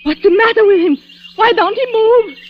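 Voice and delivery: high, frightened questions; alarmed. Acted. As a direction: ask in rising panic. Fifi alarmed at the body.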